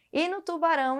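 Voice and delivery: normal talking voice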